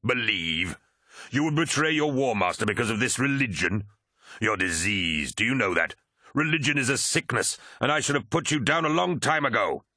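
scornful rant, pitch climbing; acted contempt. Commander scorning a believer's religion, angry and contemptuous. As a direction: berate them with rising outrage.

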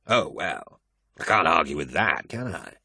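dry, sarcastic mock concession; mocking a friend's faith with sarcasm. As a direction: pretend to give in, sarcastically. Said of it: "Spare me your sarcasm".

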